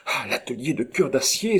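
mild cry of realisation; exclaimed. A narrator's reading. Rose guessing where he has gone, exclaimed. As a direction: exclaim as it dawns on you. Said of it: "s'écria Rose".

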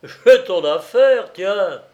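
loud, high and drawn out; delighted exclamation. A narrator's reading. Benjamin tells his friend he has it, triumphant cry. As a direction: announce the brilliant solution triumphantly. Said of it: "s'écria-t-il".

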